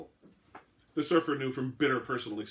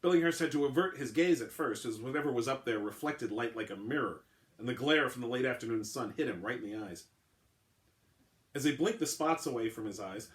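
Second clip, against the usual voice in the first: ordinary delivery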